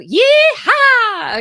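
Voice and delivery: a high, loud yee-haw falling away; cowboy yee-haw. Shown as a demonstration. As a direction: whoop like a cowboy lassoing a horse. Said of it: "we're gonna be like a cowboy".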